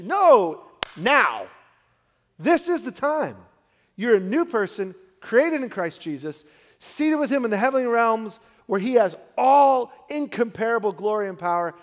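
loud, high, fervent preaching; preaching climax. Acted. Pastor preaching to the congregation, loud and fervent. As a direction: lift the room to the glory and power.

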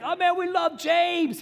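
very high, drawn-out mock adoring squeal; gushing fan. Listeners fawning over James, gushing in mock falsetto. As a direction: put on a silly high fan voice.

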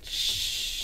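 long drawn shush; mock shush. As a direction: shush them, imitating a fed-up mom. Mom shushing sobbing daughter.

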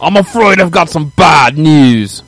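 slow, menacing English accent impression; Bad News Barrett accent. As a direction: drawl it like a British wrestling villain. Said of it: "you just sounded like".

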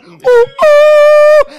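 high loud whooping call; shouting up to balcony. Shown as a demonstration. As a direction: whoop up to a window. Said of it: "call a friend through a window".

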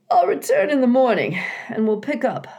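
high, loud, quick beaver voice; a beaver signing off for the night. A narrator's reading.

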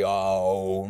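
deep, sustained divine drone; voice of God. Acted. Teacher imitating the voice coming from heaven, deep drone. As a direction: hold one long note far below normal pitch. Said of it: "The voice of God".

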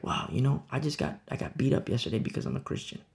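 soft, almost whispered confession; hushed testimony. Acted. Persecuted believer softly answering the preacher. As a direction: quietly tell of being beaten. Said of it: "And you're like".

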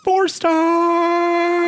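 high, held, loud call; theatrical sustained proclamation; triumphant announcement. Acted. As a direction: belt out the rating on one long high note. Announcing the review's rating theatrically.

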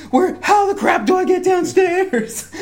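high-pitched comic frenzy; frantic and lost, hammed up with a laugh; mock panic. Retold frantic rush to get downstairs.

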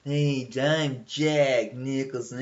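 slow, lightly voiced cheesy Nicholson; a hint of hack Nicholson; mocking Jack Nicholson. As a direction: drag it out like a bad stand-up. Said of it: "I hate that voice".

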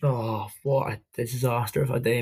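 ordinary delivery

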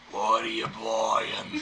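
low, slow, grumbling order-taker; bored gruff waitress. Acted. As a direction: sullen and done with the day. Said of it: "slumps her shoulders".

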